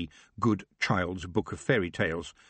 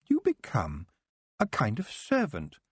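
mild sniffing disdain; understated scorn; sniffy; 2 semitones higher than usual. Acted. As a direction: elf looking down on service. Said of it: "Nightshade sniffed".

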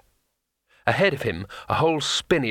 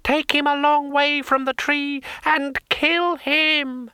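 very high, slow, shrieking command; screaming; slower than usual. Acted. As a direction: shriek the death order. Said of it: "she screamed".

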